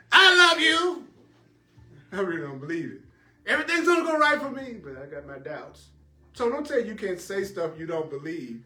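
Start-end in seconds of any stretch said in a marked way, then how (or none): 0.1-1.1 mock gushing. 3.5-4.8 mock cheerful affirmation.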